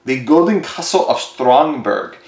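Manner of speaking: slow, hammed-up German accent on Stromberg; bad German accent. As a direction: say it like a movie villain with a German accent. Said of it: "I know my bad German accent there".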